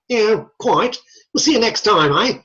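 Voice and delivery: put-on teacher waving the class off in a jokey British-Australian accent; mock British accent. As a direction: play at a British sign-off that comes out Australian. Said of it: "that sounds more Australian".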